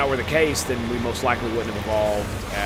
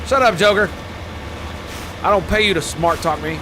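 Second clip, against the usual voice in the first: mock scolding; 5 semitones higher, 3 dB louder than usual. Snapping back at game pilot.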